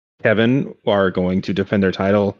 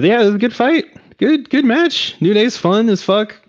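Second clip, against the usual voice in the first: much higher, excited praise; enthusiastic; 12 semitones higher than usual. Real, not acted. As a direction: gush about a great match. Enthusing about the match.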